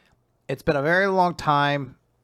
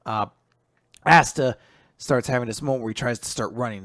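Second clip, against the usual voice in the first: normal talking voice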